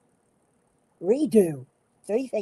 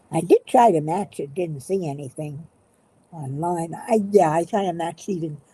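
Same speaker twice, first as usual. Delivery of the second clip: normal talking voice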